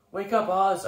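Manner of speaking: calling out to a fallen friend; shouted. Acted. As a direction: shout to wake him. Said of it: "I shouted".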